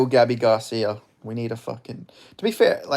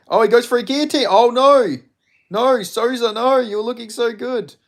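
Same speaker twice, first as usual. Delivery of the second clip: real alarmed shouts, high and loud; anxious cries as the fighter gets caught; excited; 11 semitones higher, 7 dB louder than usual. Real, not acted.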